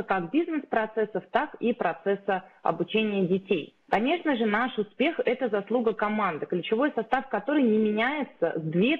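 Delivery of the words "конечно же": ordinary talk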